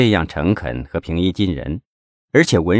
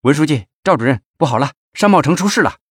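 fast, high-pitched urgent report; hurried; 8 semitones higher, faster than usual. A narrator's reading. Deputy secretary runs up with bad news, flustered and hurried. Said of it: "急急忙忙地说".

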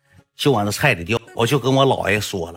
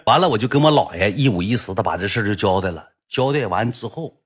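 usual speaking voice

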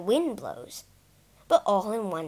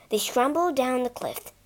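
ordinary delivery, nothing marked

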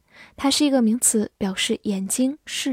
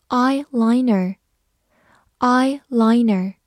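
slow, clear vocabulary repeat; slow model word; 4 dB louder, much slower than usual. Shown as a demonstration. Teacher modelling new words slowly for the class. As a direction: say each word slowly twice. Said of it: "teacher models vocabulary slowly".

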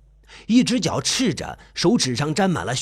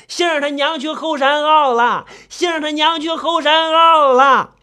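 frantic hollering; 9 semitones higher, 5 dB louder than usual. Acted. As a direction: raise the alarm, stretching the last words. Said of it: "嚷嚷着".

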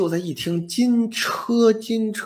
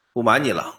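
ordinary delivery

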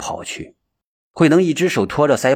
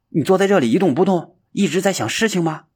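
难以置信，语速加快; 不敢相信地追问; 吃惊追问; 7 semitones higher, faster than usual. Acted. 山子惊讶跳起. (难以置信地追问)

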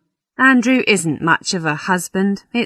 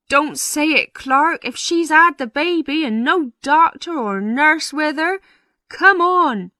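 high-pitched, anxious parson voice; flustered parson; 10 semitones higher than usual. Acted. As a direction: fret urgently at your clerk. Parson frets over the woman.

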